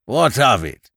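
gruff farmer challenging a visitor; surly demand. A narrator's reading. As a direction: demand it curtly. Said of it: "demanded the voice".

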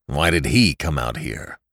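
put-on a father quizzes his daughter about the young man, suspicious. A narrator's reading. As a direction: press her for an answer, low.

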